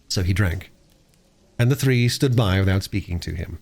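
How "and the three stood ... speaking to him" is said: usual speaking voice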